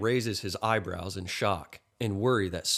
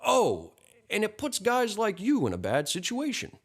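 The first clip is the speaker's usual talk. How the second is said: high-pitched, apologetic afterthought; hasty backtracking; 12 semitones higher than usual. A narrator's reading. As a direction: add it hurriedly in a raised pitch. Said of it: "The man notices and quickly adds".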